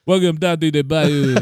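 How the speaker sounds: lightly done Creole bayou accent; a playful Creole greeting, front of the mouth. Shown as a demonstration. Host demos a Creole accent for his cohost, Creole accent. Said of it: "talking at the front of your mouth".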